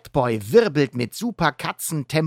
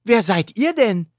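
Catboy asking; 9 semitones higher, 5 dB louder than usual. Acted. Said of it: "fragt Catboy".

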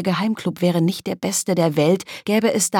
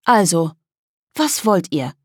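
casual. Acted. Girl asks the boys what they want, casually. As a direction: toss it off lightly, unbothered. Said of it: "fragte lässig".

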